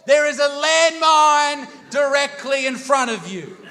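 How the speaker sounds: high, loud alarmed yell; screaming warning. Retold or imitated. Dad screams at young Matt about a landmine, loud and high. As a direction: bellow the warning like a panicked dad. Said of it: "he screams at young Matt".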